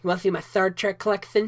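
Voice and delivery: put-on lisping geek voice. Showing off a Star Trek collection, lisping mock nerd. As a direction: lisp through it as a pretend nerd. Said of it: "you sound like a stereotypical, like, nerd".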